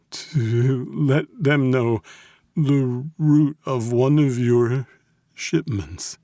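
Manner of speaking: high, very slow, halting; frightened confession. A narrator's reading. Captive confesses betrayal to mob boss, fearful. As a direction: drag the words out in dread.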